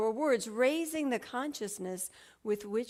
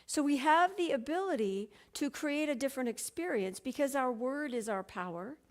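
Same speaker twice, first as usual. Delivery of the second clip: normal talking voice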